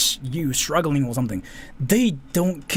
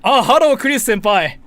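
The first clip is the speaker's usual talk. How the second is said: much higher and louder, laughing through a hammed-up fan voice; mock anime fan greeting; 8 semitones higher, 9 dB louder than usual. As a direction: play an overeager weeb greeting his senpai. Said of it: "surprise you with how fluent my English is".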